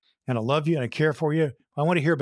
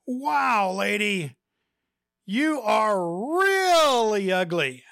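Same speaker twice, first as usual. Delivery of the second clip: much higher, drawn-out parrot voice, louder; squawky parrot; 8 semitones higher, 3 dB louder, much slower than usual. Acted. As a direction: play a rude talking parrot. Parrot taunts woman again.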